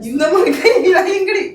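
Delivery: high mocking echo of her words; mocking mimic. Husband mockingly echoes his wife, high and squeaky. Husband mocks wife's gesture.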